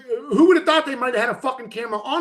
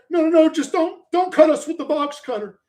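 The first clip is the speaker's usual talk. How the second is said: mock pleading; 7 semitones higher, 4 dB louder than usual. Mocking the passengers begging.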